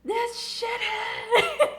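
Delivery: high, slow mocking sneer; sneering skater voice. Teen skater thinking of his rival, high slow sneer. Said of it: "he's like".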